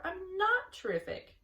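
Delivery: high, slow piglet voice; humble Wilbur. A narrator's reading. Wilbur protesting to Charlotte, high and slow. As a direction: protest shyly like a little pig. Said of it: "said Wilbur".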